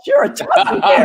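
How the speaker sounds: high, amazed gushing; mock amazed stranger. Plane passenger marvels at her, in mock amazement. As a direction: gush at a talking bear, mockingly. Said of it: "It's like".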